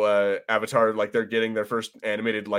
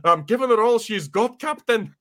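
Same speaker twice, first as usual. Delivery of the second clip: put-on higher, botched Scottish accent that slides elsewhere; bad Scottish accent; 8 semitones higher than usual. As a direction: do a Scottish accent badly on purpose. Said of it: "I always end up sounding like".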